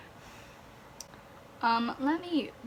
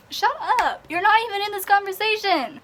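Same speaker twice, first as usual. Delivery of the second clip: high, loud, fast shouting; furious high shout at a critic; angry yell; 8 semitones higher, 4 dB louder than usual. Real, not acted. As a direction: shout down the person who butted in. Yelling at an absent critic.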